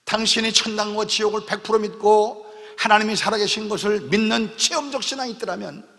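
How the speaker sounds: ordinary delivery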